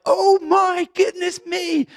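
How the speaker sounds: very high, slow, loud astonishment; amazed exclamation. Acted. Reader discovering the prophets point to Jesus, amazed exclamation. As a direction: exclaim it in drawn-out wonder. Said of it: "you start reading it, and it's like".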